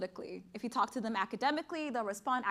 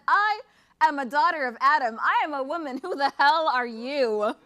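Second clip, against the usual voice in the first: defiant mother; 4 semitones higher, 10 dB louder than usual. Acted. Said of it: "she said".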